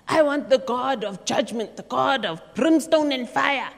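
mock angry father, loud; imitating a cross father. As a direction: play the cross father demanding judgment. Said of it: "the father comes in cross".

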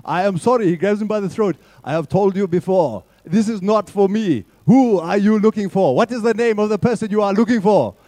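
grab him and demand an answer; angry Mandela. Pretend. Youth group hears an angry Mandela grab the salesman by the throat. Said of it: "getting a bit mad".

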